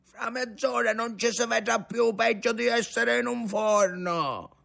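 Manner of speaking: high, carrying complaint. A narrator's reading. Sailor grumbles as darkness falls.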